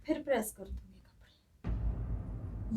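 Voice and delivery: hushed, breathy whisper trailing off, last word very slow. Acted. Mother-in-law promising her daughter-in-law in a hushed whisper. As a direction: whisper it so the guest won't hear.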